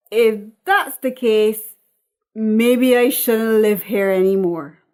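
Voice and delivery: mocking, loud angry retort; Patrice. Patrice to her mother, loud mocking retort. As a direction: twist her words back at her. Daughter fires back.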